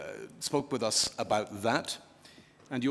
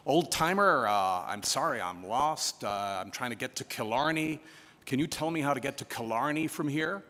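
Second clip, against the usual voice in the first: hammed-up American tourist twang, raised at the start; poor American accent; 4 semitones higher than usual. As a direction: put on a bad American accent for a lost tourist. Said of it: "please forgive the poor American accent".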